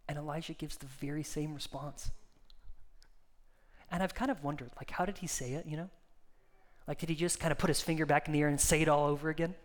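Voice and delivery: ordinary delivery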